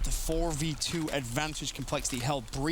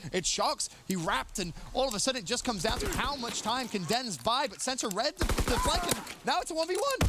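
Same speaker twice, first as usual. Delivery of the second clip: ramp up the call as the round closes; rising excited call; 9 semitones higher than usual. Real, not acted. Climax of clutch call.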